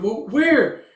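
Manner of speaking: high, loud, panicked question; high-pitched frantic bewilderment; bewildered cry. Acted. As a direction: demand it in acted alarm. Bewildered character asks where.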